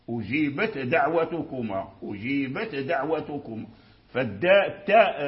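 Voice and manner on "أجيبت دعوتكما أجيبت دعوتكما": أجيبت with its ت clearly voiceless and separate before دعوتكما; clear ta before dal. Shown as a demonstration. Teacher showing students the clear ta in أجيبت before دعوتكما. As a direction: sound the ت in أجيبت crisply, then a light د. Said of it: "فاللسان يقفز قفزتين".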